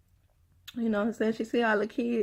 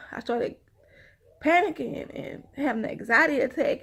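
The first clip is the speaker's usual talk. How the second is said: usual speaking voice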